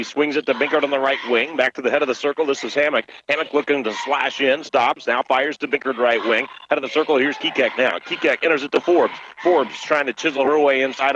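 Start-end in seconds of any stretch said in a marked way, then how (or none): none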